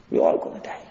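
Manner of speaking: hushed whisper; grave whispered reminder. Real, not acted. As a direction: lean in and whisper it. Grave aside to audience.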